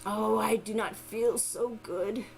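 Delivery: slow, low and feeble; weak voice. Acted. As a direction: sound drained and sickly. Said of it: "in a very weak voice said".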